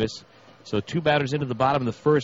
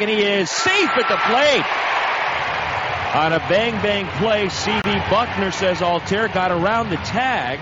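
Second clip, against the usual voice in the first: shouted, higher play-by-play; excited shouting call; 7 semitones higher, 3 dB louder than usual. Acted. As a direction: call the play at the plate at full voice. Close play at the plate.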